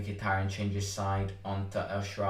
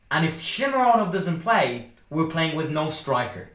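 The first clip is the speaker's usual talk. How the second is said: higher, louder frustrated rant; angry rant; 5 semitones higher, 5 dB louder than usual. Real, not acted. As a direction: vent at the club's bad decision. Ranting about the transfer.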